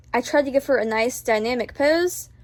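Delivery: ordinary talk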